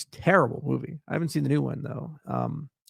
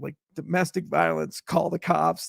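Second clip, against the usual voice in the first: ordinary talk, nothing marked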